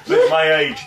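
high squeal through a laugh. Real, not acted. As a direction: laugh the words out. Laughing at own forgetfulness.

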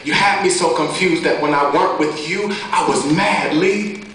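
driving, rhythmic slam-poetry delivery; steady spoken-word flow; spoken-word poem. Acted. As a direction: punch the rhymes as you recite.

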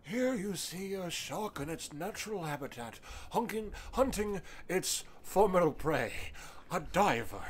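documentary narrator voice; nature documentary. Pretend. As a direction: narrate like a wildlife film. Mock wildlife commentary.

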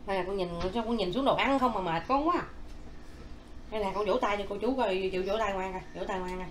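normal talking voice

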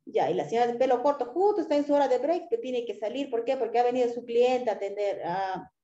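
ordinary talk

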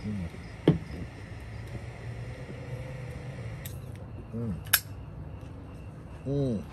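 humming while puffing smoke; cigar in mouth. Real, not acted. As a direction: lips closed around cigar. Seen 0: Man on right keeps lit cigar in lips, exhaling smoke while humming.

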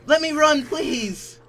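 high, loud pleading cry; real panic shouting please; desperate plea. Real, not acted. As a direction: beg to get away. Stuck, begging to escape.

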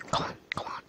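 urgent whisper. Real, not acted. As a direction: whisper it to stay hidden. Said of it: "start being quiet now".

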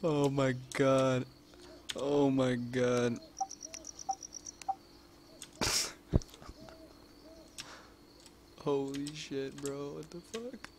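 very slow, drawn-out oh my god; dragged-out amazed oh my god; stunned disbelief. Real, not acted. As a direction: let it out slowly in disbelief. Stunned reaction.

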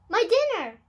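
high, loud burst of sudden delight; excited cry. Acted. Witch crying out in delight about her dinner. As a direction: shout it out like a greedy discovery.

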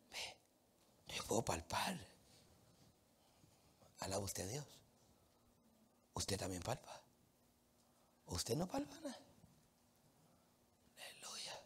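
soft, near-whispered preaching; softly confiding to the congregation; hushed. Acted. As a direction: drop to a hush.

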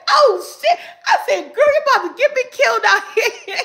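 high, laughing, quick outburst; laughing exclamation. Retold or imitated. Retelling granddaughter's trick, laughing outburst. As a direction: laugh it out in disbelief.

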